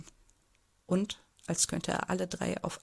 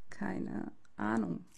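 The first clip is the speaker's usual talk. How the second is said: shaky voice; 5 dB quieter, much slower than usual. Acted. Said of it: "sage ich mit zittriger Stimme".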